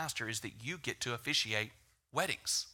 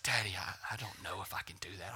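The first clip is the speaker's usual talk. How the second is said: put-on softer, unsure child's whisper; scared whisper; 7 semitones lower, 9 dB quieter than usual. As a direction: breathe it out like a frightened kid. Scared daughter voiced, whispered.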